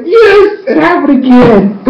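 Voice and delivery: high, squealing fan joy; so happy. Retold or imitated. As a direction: celebrate a long-awaited kiss on screen. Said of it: "I was so happy".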